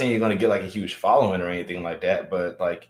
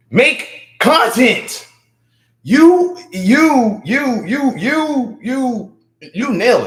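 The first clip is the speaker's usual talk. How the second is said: very high, loud, drawn-out hype; excited hype shout; 9 dB louder, much slower than usual. Real, not acted. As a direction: shout it high and stretch each word. Urging a chatter to make content.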